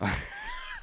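squeaky, high creature cackle; monster laugh. Pretend. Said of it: "I sound like the hormone monster".

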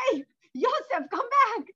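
high, loud mock call after someone. Rabbi chasing the thief, high loud mock shout. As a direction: shout after him to come back.

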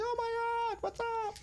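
very high-pitched mock squeal of a mom; friend's mom greeting. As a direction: imitate an excited mom in a sky-high voice. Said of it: "friend's mom walked in. She's like".

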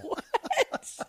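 shocked what. Retold or imitated. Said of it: "I was like".

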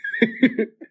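very high electronic-style droid shriek; R2-D2 scream impression. Shown as a demonstration. Showing off an audition impression, shrieking droid scream. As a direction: squeal like a little robot getting zapped. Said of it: "and he does this".

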